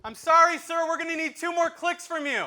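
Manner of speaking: put-on high, squeaky teen voice; teen ride attendant. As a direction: play the nervous kid running the ride. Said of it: "he just goes".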